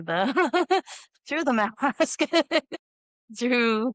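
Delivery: words broken up by laughter; laughing. Real, not acted. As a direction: laugh through the words, pitch rising. Breaks up laughing while talking.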